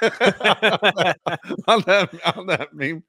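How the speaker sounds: words spilling out through laughter; can barely get it out for laughing. Real, not acted. Laughing while telling the meme.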